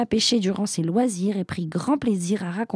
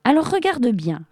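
raised, challenging cry; mild roar; 7 semitones higher, 4 dB louder than usual. A narrator's reading. Genie about to prove himself to fisherman, roaring lightly. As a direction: push pitch up in a restrained roar. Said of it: "rugit le génie".

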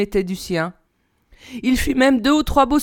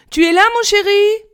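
high-pitched motherly call through a door; raised, questioning call to a child; 10 semitones higher, 4 dB louder than usual. A narrator's reading. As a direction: call sweetly up to your son, pitched well up. Mother calls through son's door.

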